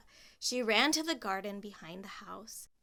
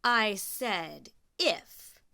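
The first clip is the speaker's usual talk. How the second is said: very slow, loud cruel stress on if; 8 dB louder, much slower than usual. Acted. Stepmother to Cinderella, slow and louder, stressing if. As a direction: drag it out, savouring the cruelty. Smiled a horrid smile.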